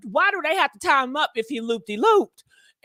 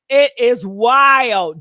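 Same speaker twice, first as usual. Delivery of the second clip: it is wild, dragged out slowly and punched louder; slow emphatic; 3 semitones lower, 8 dB louder, much slower than usual. Real, not acted. Gossip host stressing how wild the news is, slow and loud. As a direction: stretch every word of "it is wild" and hit it hard. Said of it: "when I tell you this stuff".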